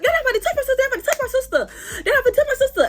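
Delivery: very high-pitched mocking repetition; mock her protest in a squeal, over and over; mimicked protesting. Girl insisting nobody will touch her sister, high mimicked protest.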